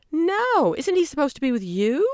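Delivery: startled confusion; surprised. A narrator's reading. Margie answering the frantic man, surprised.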